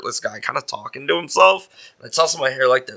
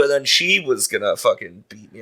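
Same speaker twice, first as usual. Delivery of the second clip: ordinary delivery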